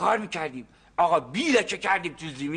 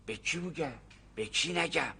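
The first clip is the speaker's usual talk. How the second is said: hushed fret; 3 semitones lower, 9 dB quieter than usual. Acted. Farmer frets to the engineer about the find, hushed. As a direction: fret quietly so nobody hears. Worried whisper about valuable.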